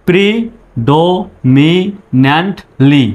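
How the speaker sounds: drawn out syllable by syllable; slow teacher demo; slow broken up. Shown as a demonstration. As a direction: break it apart slowly first. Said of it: "একসঙ্গে বলুন এইভাবে".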